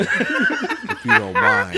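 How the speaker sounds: slow, smooth seductive radio voice; sexy voice. Acted. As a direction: purr it out like a late-night DJ. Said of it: "He put on the sexy voice".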